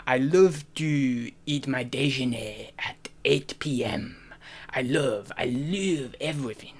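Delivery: slow, soft French accent with stretched words. Shown as a demonstration. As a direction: savour each phrase in a languid French accent.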